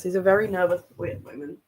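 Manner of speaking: ordinary talk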